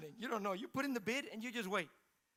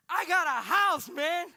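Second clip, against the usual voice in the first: loud, high announcement to a friend; excited bragging; 6 semitones higher, 12 dB louder, slower than usual. Acted. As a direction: crow the good news at full voice. Imagined bragging to friend Wes.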